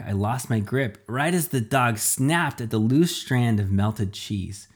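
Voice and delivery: ordinary delivery, nothing marked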